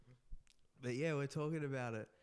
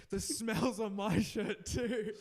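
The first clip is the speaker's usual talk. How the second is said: higher, louder, slow realization; dawning realization; 6 semitones higher, 5 dB louder, slower than usual. Retold or imitated. Friend realizing the perfume is on his shirt too, in alarm. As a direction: stretch it out as it dawns on you. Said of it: "you paused and you were like".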